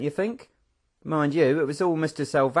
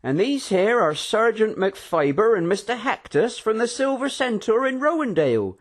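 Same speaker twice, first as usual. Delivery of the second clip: lightly voiced host; 9 semitones higher, 4 dB louder than usual. A narrator's reading. Algovian continues introductions.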